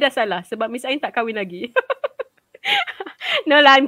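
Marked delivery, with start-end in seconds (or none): 1.8-2.2 laugh. Real, not acted. Teacher jokes about not married.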